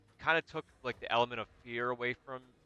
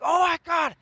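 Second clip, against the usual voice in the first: much higher, much louder shriek; scream; 12 dB louder than usual. Retold or imitated. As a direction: shriek it in terror. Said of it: "and I scream".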